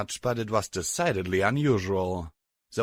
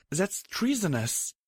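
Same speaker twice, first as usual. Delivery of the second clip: slow, higher, uneasy; nervous; 10 semitones higher than usual. A narrator's reading. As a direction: say it nervously, shocked. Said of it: "she said nervously".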